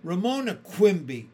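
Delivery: loud, high shriek of the full name; girl shrieking at a pest. A narrator's reading. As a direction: scold her by her full name at top voice. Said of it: "shrieked Susan".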